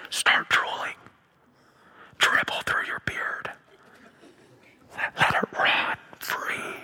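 breathy, hushed whisper of a mock divine voice; mock holy whisper. Pastor mocking the idea of God's still small voice, hushed whisper.

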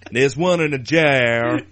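over-the-top botched American drawl. Acted. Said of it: "no idea what accent that's meant to be".

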